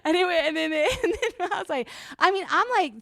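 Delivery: real laughter breaking into the telling; laughing. Real, not acted. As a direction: giggle through the words. Laughing through the story.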